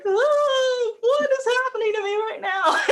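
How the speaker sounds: high, laughing and near tears; overwhelmed surprise. Retold or imitated. Retelling how the father's story hit her, in high teary surprise. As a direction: caught off guard by your own emotion. Retold moment of being moved.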